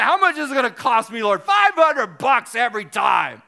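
loud, high mock shouting; Bob Goff impression. Retelling him asking the Lord the cost, shouted impression. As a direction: shout the exchange with comic exuberance. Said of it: "And this is how he talks".

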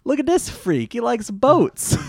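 high, loud, sneering mock disgust. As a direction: sneer like it's a perverse act. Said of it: "He says it like it's a perverse act".

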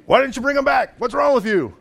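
high, indignant mock scolding of the guards; exasperated demand. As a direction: play the fed-up leaders demanding answers. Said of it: "They're like".

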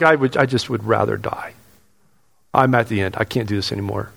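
a quiet, worn-out wish to quit; weary despair. Retold or imitated. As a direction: sigh it out like you've given up. Despairing inner voice, it's like.

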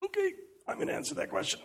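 high, fast mock voice answering; mock eager voice. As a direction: squeak it out quickly like a keen student. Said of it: "I find myself going".

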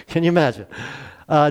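ordinary delivery

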